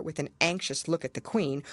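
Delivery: ordinary delivery, nothing marked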